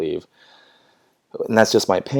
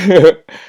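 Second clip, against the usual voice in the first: burst of laughter; 7 semitones higher, 11 dB louder than usual. Real, not acted. Laughing after face-touching joke.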